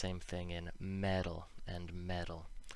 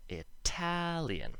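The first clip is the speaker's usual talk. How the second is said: "Italian" with "TAL" high and held, then falling; slow, exaggerated second-syllable stress on "Italian"; emphasized second syllable; 9 semitones higher, slower than usual. Shown as a demonstration. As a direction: stretch the middle of "Italian", with a true T. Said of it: "the al is emphasized".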